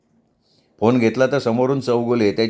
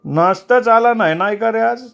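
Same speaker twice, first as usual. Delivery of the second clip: pitched up well above the narration, a hungry man's protest; high-pitched grumbling that no breakfast arrived; high complaining voice; 10 semitones higher, 4 dB louder than usual. Acted. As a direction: whine that the breakfast never came. Hungry man complains mid-talk.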